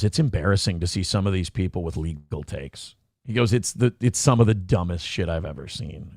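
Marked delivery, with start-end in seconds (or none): 0.0-2.9 low, dry put-on lawyer voice, softer; lawyer's voice. Lawyer scoffing at fans' legal takes, low deadpan voice. Retelling his lawyer's remark. 3.5-6.1 low, dry put-on lawyer voice, softer; lawyer's voice. Lawyer scoffing at fans' legal takes, low deadpan voice. Retelling his lawyer's remark.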